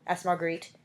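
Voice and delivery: ordinary delivery, nothing marked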